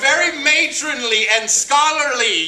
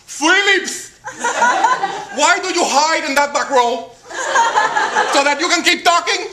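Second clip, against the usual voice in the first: high, stretched-out teacher's reprimand; Mrs. Martinez scolding; 8 semitones higher, 3 dB louder than usual. Acted. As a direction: call out the troublemaker in the back row. Acted teacher scolding pupil in back row.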